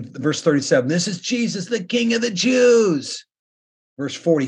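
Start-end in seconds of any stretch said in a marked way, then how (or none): 0.9-3.3 crowd's mock title, called out high; mocking jeer. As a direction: taunt him like a crowd at the cross. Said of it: "they mocked him saying".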